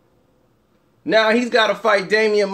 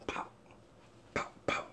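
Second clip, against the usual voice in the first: whispered pow; 24 dB quieter than usual. Pretend. Fighter whispering punch sounds to himself, voiceless.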